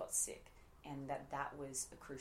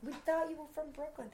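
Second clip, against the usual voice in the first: put-on quick Brooklyn accent, pitched up high; 13 semitones higher, 6 dB louder than usual. Fans quoting her Brooklyn accent back to her, in a high Brooklyn voice. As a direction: toss off a Brooklyn voice. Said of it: "I got a lot of credit after Wolf of Wall Street".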